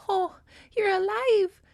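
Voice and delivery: high-pitched, emotional cry of relief; acted child's joy, very high and drawn out; overjoyed relief. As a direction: squeal it as the girl finding the babies alive. Voices overjoyed girl finding siblings alive.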